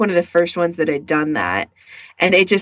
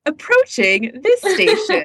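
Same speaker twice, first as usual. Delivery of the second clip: imitating train announcement; 9 semitones higher than usual. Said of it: "they'd be like".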